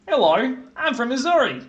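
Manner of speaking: put-on high, loud Australian-style "Missouri" accent; hammed-up fake Missouri accent; mock Missouri accent. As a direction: put on a silly Aussie twang as a fake Missourian. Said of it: "we can do the Missouri accent".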